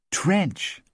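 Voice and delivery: TRENCH pitched high, standing alone; emphatic correction. A narrator's reading. Correcting a misheard name.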